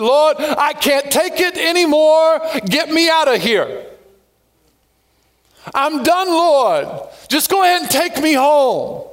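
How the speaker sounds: high, slow, pleading cry to the Lord; imitating a weary believer crying out to God; despairing prayer. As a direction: beg God like you're completely spent.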